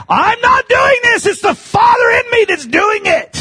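high, forceful shouted quotation; preaching shout. Retold or imitated. Preacher quoting Jesus to the crowd, shouted high. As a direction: cry it out at full preaching pitch. Repeats the quote as preached climax.

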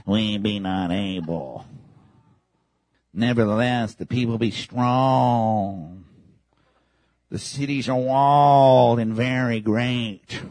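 low, slow, sulky complaining; whiny faithless spies. Retold or imitated. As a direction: mope it out like defeated men. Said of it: "That's what they sound like to God".

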